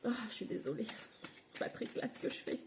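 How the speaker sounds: struggling to breathe, hushed; short of breath, words half whispered. Real, not acted. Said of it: "J'arrive pas à respirer". (à bout de souffle, voix étouffée)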